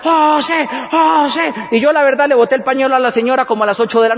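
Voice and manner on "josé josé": higher-pitched fan chant; mock cheer for a star; imitated crowd shout. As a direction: call the name like a whole crowd chanting. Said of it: "todos comenzaron a gritar".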